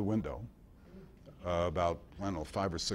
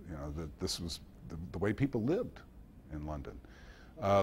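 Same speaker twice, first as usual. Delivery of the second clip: ordinary delivery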